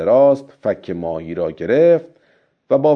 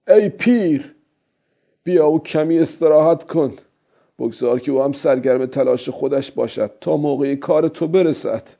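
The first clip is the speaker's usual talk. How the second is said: put-on higher-pitched old fisherman's voice; old man talking to himself; 6 semitones higher than usual. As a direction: coax yourself as the old fisherman.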